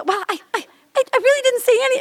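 high-pitched, rushed, stammering excuse; mock backtracking. Wife caught out by husband, mock flustered backtracking. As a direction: imitate scrambling to take it back. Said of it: "like, backtread".

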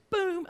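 high-pitched boom sound effect; a sudden high boom for the bump; vocal impact noise; imitated thud. Imitating a head knock.

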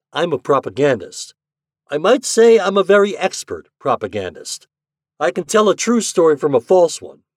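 loud, high, exasperated man; angry reporter. A narrator's reading. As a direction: lay down the law, fed up. Angry reporter confronts her.

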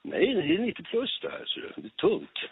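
mock insistence. Spelar boxaren som står på sig. (spelat envis)

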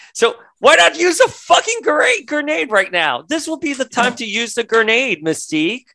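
high-pitched, hammed-up exasperation; exasperated rant. Mock-scolding Mystique over the unused grenade.

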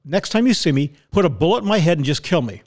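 ordinary talk

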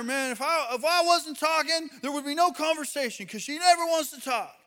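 put-on a fed-up dad venting about his teenage daughter; frustrated dad. A dad at the gym complaining about his daughter, exasperated and fast.

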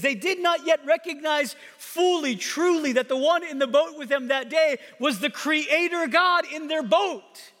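high-pitched, forceful sermon delivery; impassioned preaching. Real, not acted. Preacher on disciples not recognizing their creator, impassioned preaching. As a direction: drive each phrase home with rising fervour.

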